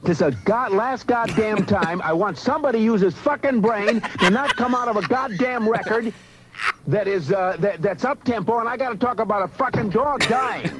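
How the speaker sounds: rising into a full furious shout; furious rant. Real, not acted. [name] rant escalating to shout.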